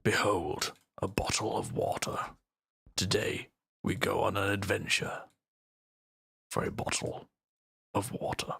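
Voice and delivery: hushed, theatrical mock Shakespearean declaiming; hammed-up Shakespearean whisper. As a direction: play it like a grand stage hero, half whispered. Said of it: "very Shakespearean".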